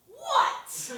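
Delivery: high sharp challenging what; high-pitched indignant what; mock confrontational what. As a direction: snap it at him, half laughing. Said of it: "I went".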